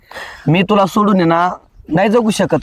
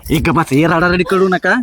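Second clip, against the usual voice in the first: ordinary talk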